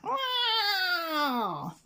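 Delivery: high, drawn-out falling cat wail; cat yowl. A narrator's reading. Scarface Claw in the tree, a long high yowl. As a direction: wail it like a cornered tomcat. The cat's yowl echoes around.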